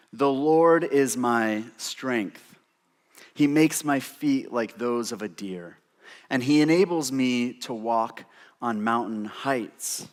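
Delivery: slow, measured reading of the verse; scripture reading. Acted. Preacher reads Habakkuk's prayer to the church, measured. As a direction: read the prayer reverently.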